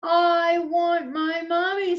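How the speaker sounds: high, loud little owlet wail; high put-on baby voice, loud. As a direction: wail for mother like a baby owl. Voicing baby owl Bill.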